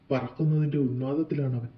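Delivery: usual speaking voice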